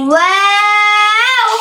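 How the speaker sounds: ওয়াও held long and steady, recited; long drawn waw. Shown as a demonstration. Tajweed teacher showing pupils the long ওয়াও. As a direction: draw ওয়াও out slowly for three beats. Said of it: "তিন আলিফ টেনে পড়তে হবে".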